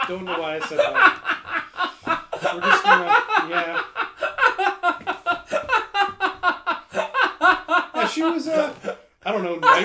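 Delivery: high, loud words breaking into laughter; helpless laughter. Real, not acted. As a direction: try to talk while cracking up. Breaks up over saying dame.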